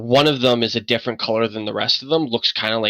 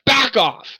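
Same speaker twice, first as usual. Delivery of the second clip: higher, rising shout; shouting at dog; 12 semitones higher than usual. Acted. Old man shoving the door, shouting at the dog. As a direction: push the dog back with your voice. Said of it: "shove into it a little bit and go".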